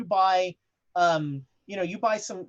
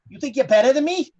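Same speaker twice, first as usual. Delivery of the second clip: loud, higher Boston challenge; Boston accent, picking a fight; Boston attitude; 5 semitones higher, 8 dB louder, faster than usual. Shown as a demonstration. GM demos the Boston mindset, loud Boston accent. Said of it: "the Boston accent is almost as much a mindset".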